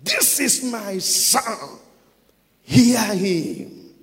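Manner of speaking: booming, drawn-out command with no pleading; commanding voice from heaven. Acted. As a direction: thunder it slowly as an order. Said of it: "He was not seeking for their opinion".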